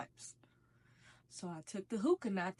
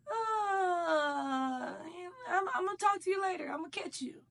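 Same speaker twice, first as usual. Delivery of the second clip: awkward brush-off; 8 semitones higher than usual. Retold or imitated. Brushing off an old acquaintance.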